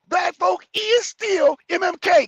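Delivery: high, loud, slow mock. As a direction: belt the error scornfully. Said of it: "Correct that".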